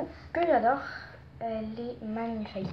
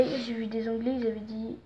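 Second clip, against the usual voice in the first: normal talking voice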